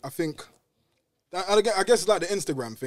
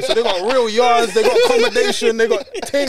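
excited; 8 semitones higher, 11 dB louder than usual. Real, not acted. To friends, marveling at big houses, loud high wonder. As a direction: stretch it out in wonder.

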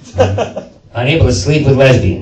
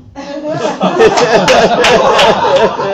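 high cackle; 10 semitones higher than usual. Real, not acted. Cackle after the punchline.

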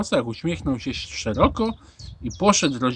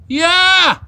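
very high, loud eager shout; shouted exclamation; 11 dB louder than usual. A narrator's reading. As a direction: shout it out like a whole table of kids. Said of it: "wykrzyknęliśmy wszyscy". (głośny, wysoki okrzyk)

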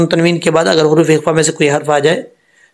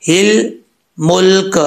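ordinary talk, nothing marked

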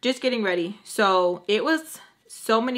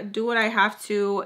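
ordinary delivery, nothing marked

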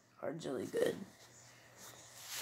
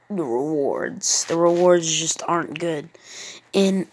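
ordinary delivery

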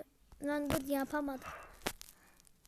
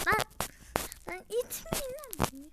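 much higher, squeaky protest; annoyed outburst; 7 semitones higher than usual. Real, not acted. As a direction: yelp at them to stop pushing. Others pushing him in the game. (itilince tiz, sinirli bir isyan)

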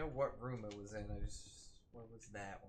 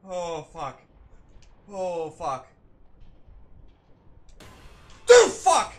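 swearing in rising terror, much louder; panicked swearing; 7 semitones higher, 17 dB louder, slower than usual. Real, not acted. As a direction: swear as panic boils over. Being chased in horror game.

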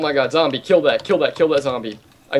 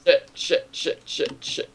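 whispered swearing; 7 dB quieter, slower than usual. Real, not acted. Panicked muttering under attack.

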